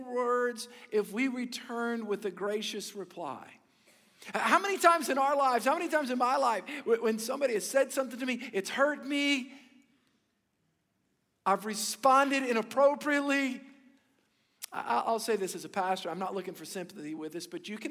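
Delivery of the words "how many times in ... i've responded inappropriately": higher and louder, pressing the point home; preaching climax. Real, not acted. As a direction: pastor rising to full preaching force.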